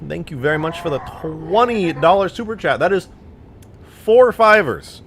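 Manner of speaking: high, loud, excited donation shout; hyped thanks. Real, not acted. Streamer thanks viewer for twenty dollar super chat, excited.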